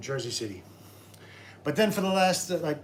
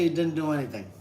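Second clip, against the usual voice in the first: ordinary talk, nothing marked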